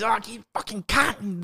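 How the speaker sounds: slow, drawn-out mock Australian accent; slow imitation of an Australian swearing; Aussie accent. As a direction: imitate an Aussie saying it, slowed down. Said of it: "grimy sound to it when the Aussies say it".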